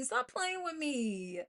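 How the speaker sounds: ordinary talk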